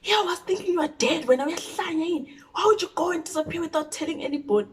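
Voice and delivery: high, fast mock scolding; yelling mom. Mother yelling at daughter from the driver's seat, mock yelling. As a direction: tear into the kid while driving. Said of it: "she's yelling at me".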